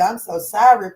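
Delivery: ordinary delivery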